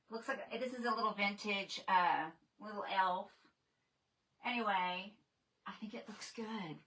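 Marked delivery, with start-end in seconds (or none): 5.7-6.8 breathy whisper. Real, not acted. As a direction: whisper it to yourself. Whispered aside while showing decor.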